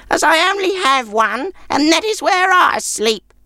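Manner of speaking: put-on very high old-woman voice, slowing at the end; grudging, high-pitched old crone; sour old woman. A narrator's reading. Old woman keeps her only bed, sour high voice.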